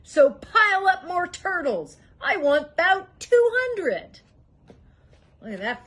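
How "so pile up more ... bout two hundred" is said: high, loud, slow royal thunder; thundering order. A narrator's reading. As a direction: roar the command down the stack. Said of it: "his royal voice thundered".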